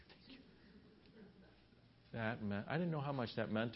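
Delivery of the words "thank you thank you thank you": whispered, grateful thanks; acted whisper of relief from a husband; hushed relief. Hushed relief at not moving.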